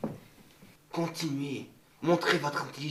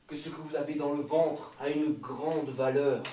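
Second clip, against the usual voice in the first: ordinary talk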